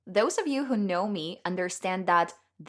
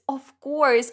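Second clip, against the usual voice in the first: "Of course" high and loud, bursting with joy; delighted "Of course"; thrilled "Of course"; 7 semitones higher, 7 dB louder than usual. Retold or imitated. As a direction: answer "Of course" like a dream come true. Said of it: "And I was like".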